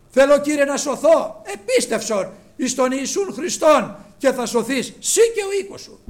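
high, shouted exhortation; full-force preaching. Real, not acted. Preacher urging listeners to believe and be saved, full-force preaching. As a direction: thunder it out to the congregation.

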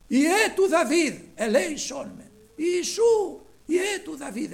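high, drawn-out cry; loud pleading shout. Retold or imitated. Blind Bartimaeus begging Jesus for mercy, loud high shout. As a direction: shout to be heard over the crowd. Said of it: "φώναζε δυνατά".

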